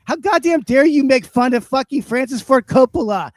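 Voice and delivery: loud, high, shouted rant; furious rant. Acted. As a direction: blast him with outrage, getting shriller. Ranting at the wine guy.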